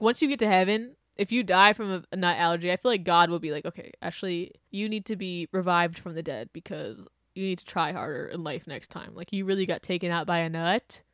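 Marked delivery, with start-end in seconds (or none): 3.7-11.0 a much softer imagined God, deadpan; hushed deadpan God voice; quiet mock God. Voicing God's reply.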